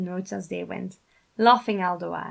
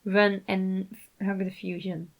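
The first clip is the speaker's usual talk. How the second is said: ordinary delivery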